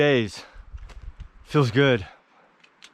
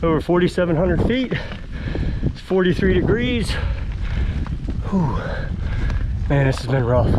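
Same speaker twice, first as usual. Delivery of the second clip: panting after a long uphill climb; out of breath, breathing hard between words; heavy breathing; 3 semitones higher than usual. Real, not acted. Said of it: "sorry for the heavy breathing".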